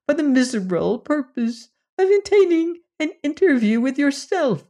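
very high, humble whimpering plea; a tiny creature bowing and begging; faint whimper. Acted. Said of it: "little more than a faint whimper".

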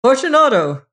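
loud, high call of Fortunato!; calling aloud. Acted. Montresor calling to the walled-in man: loud, high. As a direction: call into the niche. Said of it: "I called aloud".

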